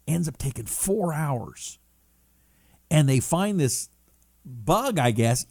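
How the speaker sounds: ordinary delivery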